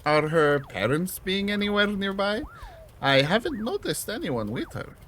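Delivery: gently formal tea host; polite character voice. Acted. Voices Boro.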